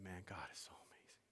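whispered in awe to his wife; awed whisper. Retold or imitated. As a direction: breathe out the wonder. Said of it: "I said".

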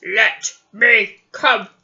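slow, drawn-out wolf call. Acted. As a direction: growl it slowly like a wolf. Wolf voice in story.

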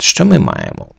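ordinary talk

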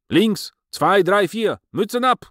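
high, loud drill count; shouted marching commands. Acted. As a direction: call the step like a drill order.

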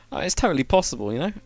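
usual speaking voice

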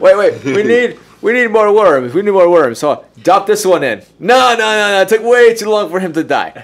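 loud, high, excited experimenter; playing a frantic ancient brewer. Acting ancient worm experimenter.

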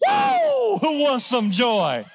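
high, loud whoop, then a slow shouted call; excited whoop. Real, not acted. Pastor rallying the congregation for joy, excited whoop. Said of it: "cheering the crowd".

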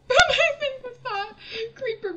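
high, loud exclamation; loud outburst. Real, not acted. Player loudly wrapping up after the creeper. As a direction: blurt it loudly.

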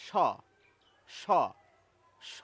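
slow, repeated isolated sibilant স demo; isolated সা sound demo. Shown as a demonstration. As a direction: say স three times, slowly and clearly. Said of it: "এর জন্য বরাদ্দ ধ্বনি হচ্ছে".

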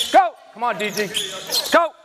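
ordinary talk

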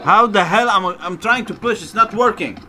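loud, high exasperated protest; frustrated. Real, not acted. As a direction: complain loudly at the stubborn game. Frustrated at stuck game move.

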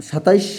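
"সাতাইশ" with an extra i-glide before the final sh; common mispronunciation. Shown as a demonstration. Teacher citing children's frequent error, mispronounced. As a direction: say "সাতাইশ" with ai in the second syllable. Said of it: "এই সংখ্যাগুলোর উচ্চারণের ক্ষেত্রে ... সমস্যাটি".

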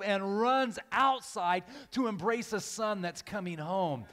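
loud, high, ringing sermon delivery; full-force preaching. Acted. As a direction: build a stirring refrain, punching each phrase.

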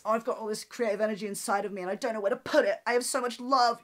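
high, fast, dreamy tortured artist voice. Retold or imitated. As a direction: gush it like a swooning tortured artist. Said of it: "I sound like a tortured artist".

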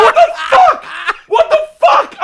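very high, full-blast disbelief; shocked scream. Retold or imitated. Comic replays seeing the man walk away unhurt, shocked scream. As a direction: drag it out in screaming astonishment.

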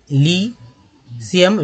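"लीसियम" very slowly as ली, pause, Siem; syllable by syllable. Shown as a demonstration. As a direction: break "लीसियम" after a long lee. Teacher repeats word broken in two.

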